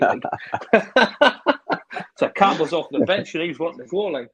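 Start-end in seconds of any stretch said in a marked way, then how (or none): 0.3-2.1 high burst of real laughter; hearty laugh. Real, not acted. Manager laughing at the injured striker wanting to play, rising laugh. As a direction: laugh out loud at the memory.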